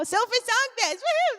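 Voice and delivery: high, delighted burst of recall; excited cheer. Real, not acted. Suddenly remembers the show's name.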